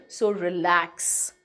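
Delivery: reassuring. Real, not acted. Teacher calming students about hard terms, reassuring. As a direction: ease their worries gently. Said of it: "teacher reassuring students".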